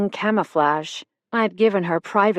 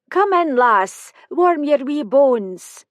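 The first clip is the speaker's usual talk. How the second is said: higher, slow motherly Scottish welcome; slow, high-pitched homely Scottish woman; voiced Nancy, Scottish; 7 semitones higher, 5 dB louder, slower than usual. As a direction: coax the lass in from the cold. Nancy welcomes narrator inside.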